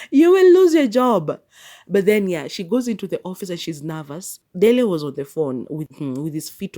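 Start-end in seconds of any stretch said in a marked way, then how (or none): 0.1-1.4 high, loud playful outcry; mock warning. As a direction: cry it out in mock disbelief.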